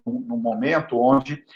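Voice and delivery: usual speaking voice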